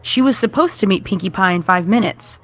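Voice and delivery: ordinary delivery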